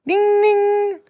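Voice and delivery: high sung ding ding; sung bell sound. Pretend. Sounds of Sillydelphia listed.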